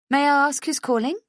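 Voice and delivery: crisp front-desk professional; brisk receptionist. A narrator's reading. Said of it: "the equally brisk receptionist asks".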